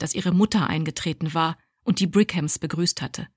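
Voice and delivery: ordinary delivery, nothing marked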